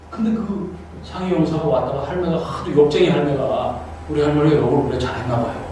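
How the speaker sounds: ordinary talk, nothing marked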